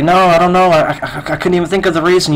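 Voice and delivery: acted high-pitched fluster. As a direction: act too rattled to get the words out. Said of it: "can't even talk 'cause she's so flustered".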